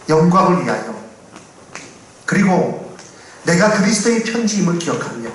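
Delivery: ordinary delivery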